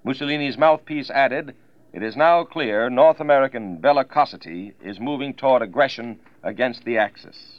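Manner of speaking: 1940s radio news read; crisp wartime bulletin delivery; old newsreel. Acted.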